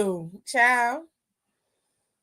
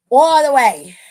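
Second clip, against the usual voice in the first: emphatic shout; 12 dB louder than usual. Real, not acted. Hyping a correct prediction.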